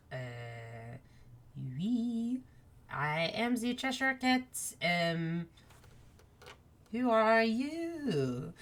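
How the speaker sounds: Chess. Acted. Chess answers an unseen tiny voice, lightly voiced puzzled cat. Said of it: "Chesh looks around and goes".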